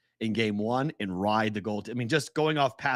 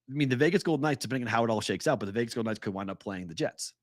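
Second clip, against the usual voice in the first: normal talking voice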